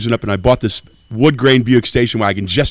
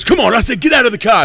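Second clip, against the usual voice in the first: high, loud challenge; mock yell; 11 semitones higher, 3 dB louder than usual. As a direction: shout it like a road bully. Woman again.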